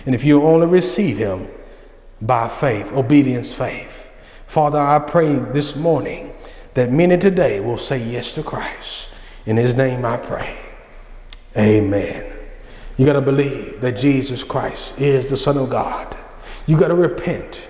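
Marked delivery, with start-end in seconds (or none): 4.5-12.2 soft, low prayer voice; hushed pastoral prayer; quiet closing prayer. Acted. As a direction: pray gently over the congregation. Preacher closes in prayer.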